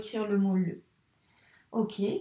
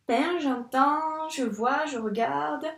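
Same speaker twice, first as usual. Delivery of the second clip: slowly stretched, listening to each sound; teacher sounding out the word slowly; 3 semitones higher, slower than usual. Shown as a demonstration. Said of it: "Pain, j'entends deux sons".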